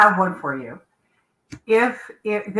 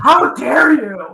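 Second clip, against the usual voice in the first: mock outrage; 5 semitones higher, 5 dB louder, much slower than usual.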